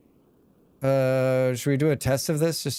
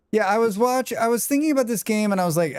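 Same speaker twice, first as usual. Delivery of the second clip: excited; 9 semitones higher, faster than usual. Real, not acted. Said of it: "excited start of story".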